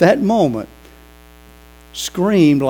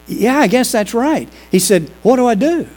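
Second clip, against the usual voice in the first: higher and louder, eager to know; eager question; 8 semitones higher, 5 dB louder than usual. Retold or imitated. Bernie asking the pastor eagerly what to do, higher and louder. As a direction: press the pastor for an answer.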